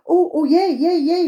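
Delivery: put-on high, loud children's cheer; delighted cheer. A narrator's reading. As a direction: cheer like thrilled kids. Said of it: "they all replied".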